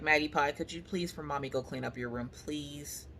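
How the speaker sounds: put-on soft, sugary ask to a child; soft mommy voice asking her daughter nicely; sweet coaxing. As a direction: coax her gently, very softly. Sweet-talking child to clean.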